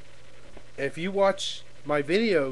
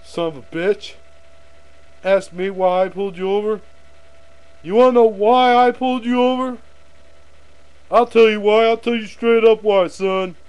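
loud, raised-pitch bullying rant; big prick cop; 5 semitones higher, 8 dB louder than usual. Acted. Cop who pulled someone over for looking funny, bullying shout. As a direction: bark it like an arrogant traffic cop. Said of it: "Look like one of those big prick cops".

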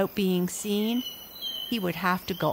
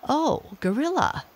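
a touch higher, polite; lightly voiced father; 8 semitones higher than usual. A narrator's reading. My father to the gorilla, lightly voiced and polite. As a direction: offer help politely. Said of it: "said my father".

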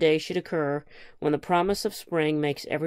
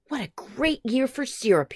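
pleased grandfather; 8 semitones higher than usual. A narrator's reading. Grandfather delighted with the syrup.

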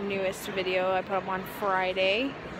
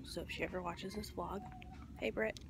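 usual speaking voice

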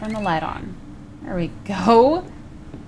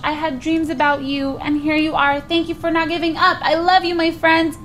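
high cat voice; 7 semitones higher, 3 dB louder than usual. Acted. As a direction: voice the cat, full of relief. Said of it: "Fran, is it really you".

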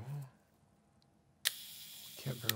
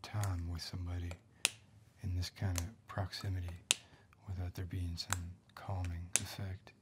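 whisper; slower than usual. Acted. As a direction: keep it all breath, no voice. Whispered roleplay throughout.

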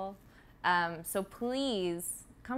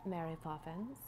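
put-on a quick British accent on Mary Poppins, softer; bad British accent; 2 semitones lower, 8 dB quieter than usual. Actress naming her old role in a British accent. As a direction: toss off the name in a mock British accent. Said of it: "That was a terrible accent just now".

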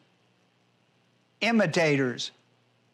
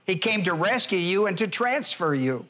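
usual speaking voice

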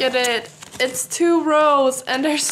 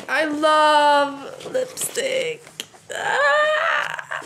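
drawn-out high gush rising to a squeal; lipstick mania; 5 semitones higher, much slower than usual. Real, not acted. Vlogger surrounded by liquid lipsticks, gushing into a high squeal. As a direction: gush slowly, then shriek with delight. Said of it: "this is how I behave".

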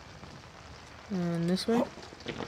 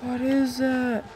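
frightened repeating; 7 semitones higher than usual. Real, not acted. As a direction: keep asking in shaky alarm, winding down. Creature seen, repeated in fright.

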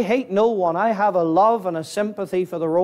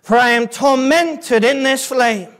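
raised, forceful sermon voice; impassioned preaching; 6 semitones higher, 4 dB louder than usual. Real, not acted. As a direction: preach the scripture loud and high, leaning on key words. Preaches the torment passage.

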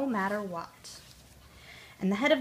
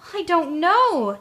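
higher, louder and slow, cross reply; 7 semitones higher, 8 dB louder, slower than usual. A narrator's reading. Tootle answering his chanting wheels, cross. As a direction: snap it back at the wheels, slowly. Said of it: "Tootle said crossly".

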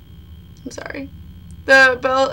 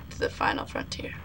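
a mock-epic line, fully whispered; 13 dB quieter than usual. Real, not acted. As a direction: whisper it like a secret quest.

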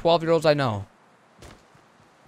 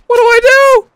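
high, loud panicked yell; real panic, loud and high; 16 dB louder than usual. Real, not acted. Panicked gamer mid-fight.

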